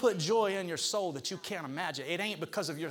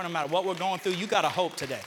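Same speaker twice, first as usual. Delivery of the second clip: ordinary talk, nothing marked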